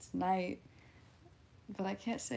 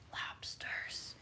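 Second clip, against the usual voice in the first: whispered punchline; 10 dB quieter than usual. Pretend.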